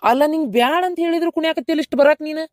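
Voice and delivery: exasperated objection. Acted. Husband objects to wife coming.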